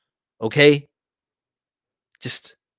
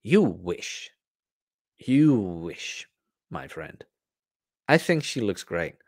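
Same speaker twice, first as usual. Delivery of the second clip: quiet cool retort; 4 semitones lower, 6 dB quieter, slower than usual. Retold or imitated. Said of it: "I'm like".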